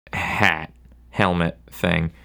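ordinary talk, nothing marked

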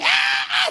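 high-pitched imitated passenger screams; mock screaming. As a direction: mimic a cabin in panic, not at full volume. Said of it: "they're all screaming".

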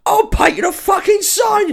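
high, loud, slow mock yell; hammed-up angry roar; mock furious shout. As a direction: bellow it like a fed-up sign painter. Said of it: "the guy's just like".

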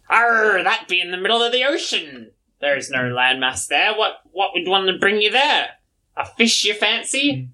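high hammed-up pirate growl; pirate salamander. Salamander calling to crewmate for drinks, pirate voice. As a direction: bellow it like a tipsy pirate captain.

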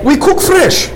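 put-on high, thick Russian accent host. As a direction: keep the heavy Russian accent going. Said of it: "Greets you with thick Russian accent".

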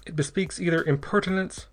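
usual speaking voice

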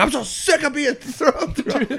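high-pitched, laughing outburst; mock exasperation. Imitating a movie hero sick of being thrown around, mock exasperation. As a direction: push the voice up high, hammed-up fed-up. Acts out character hitting breaking point.

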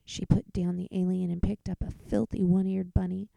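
ordinary delivery, nothing marked

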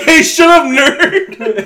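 high, loud heckle; mock bully shout. Co-host shutting up the science objection, mock bully shout. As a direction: shout down the nitpicker playfully. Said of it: "You're just like".

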